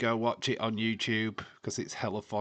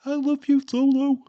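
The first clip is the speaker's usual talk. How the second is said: catchphrase impression; 11 dB louder than usual. Quoting the wrestler again.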